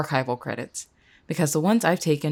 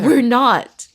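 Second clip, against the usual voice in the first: loud, higher outburst cutting in; angry shout; 5 semitones higher, 9 dB louder than usual. Acted. Jess rejects solving it together, furious shout. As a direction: explode at the offer of help.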